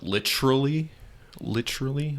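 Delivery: "literally" squashed to three syllables with a ch sound; mispronounced "literally". Shown as a demonstration. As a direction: say "literally" as "LITCH-rully". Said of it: "it's not pronounced literally".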